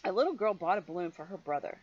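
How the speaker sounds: ordinary delivery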